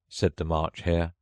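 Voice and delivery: ordinary delivery, nothing marked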